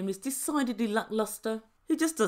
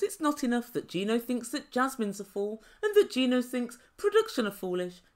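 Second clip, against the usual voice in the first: usual speaking voice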